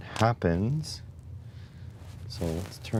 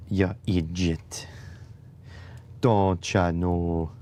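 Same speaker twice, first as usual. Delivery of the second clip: sarcastic voice; slower than usual. Pretend. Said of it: "My sarcasm is undeniable".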